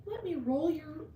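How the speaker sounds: put-on high, sweet girlfriend coo. Pretend girlfriend pampering a viewer, high and sweet. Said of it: "I'd be like".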